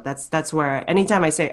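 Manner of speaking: ordinary delivery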